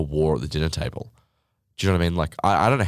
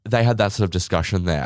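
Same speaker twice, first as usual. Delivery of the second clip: ordinary talk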